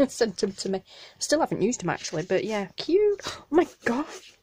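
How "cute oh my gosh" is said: high, tearful delight; delighted squeal. Real, not acted. Opening a friend's package, squealing with delight. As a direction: squeal with joy at the gift.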